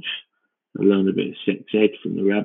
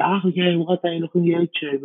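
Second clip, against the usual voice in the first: mock breezy parent; 9 semitones higher than usual. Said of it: "and go".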